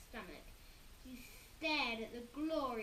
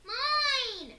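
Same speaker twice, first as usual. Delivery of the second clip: very high, loud scream; both boys screaming mine; 12 semitones higher, 14 dB louder than usual. A narrator's reading. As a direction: scream it at once. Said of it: "screamed Henry and Peter".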